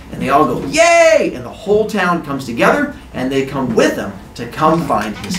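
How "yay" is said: high, drawn-out cheer; crowd cheer. Acted. Villagers agreeing to help, high loud cheer. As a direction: cheer like a whole eager town.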